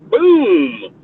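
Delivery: loud, punchy gunshot "Boom!"; a sharp, loud mock blast; imitated gunshot boom. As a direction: bark out the shot like a gun going off. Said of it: "I'm like".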